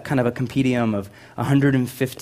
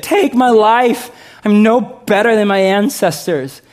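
despairing plea; 9 semitones higher, 6 dB louder than usual. Acted. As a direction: wail it to God. Elijah begs God to die.

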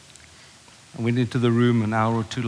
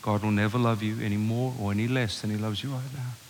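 tender; 4 semitones lower, 7 dB quieter than usual. Retold or imitated. Father repeating reassurance to son.

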